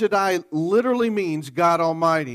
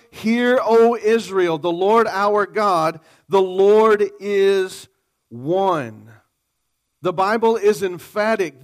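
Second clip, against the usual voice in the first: ringing, slightly raised preaching voice; preaching at full force; 3 semitones higher, 4 dB louder than usual. Acted. Preacher voicing God's claims to the congregation, fervently. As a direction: proclaim it with full conviction. Proclaiming Deuteronomy and God's words.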